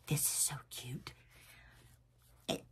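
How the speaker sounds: breathy delighted whisper; soft unvoiced gush over something cute. Real, not acted. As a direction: hush it like a secret treasure. Gushing over napkins in a whisper.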